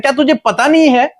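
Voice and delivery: high, emphatic, insistent preacher's voice; God reasoning with Hagar. Retold or imitated. God explains His promise to crying Hagar, high insistent reasoning. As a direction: press each question and promise home, pitched high. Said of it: "परमेश्वर उसे समझाने की कोशिश".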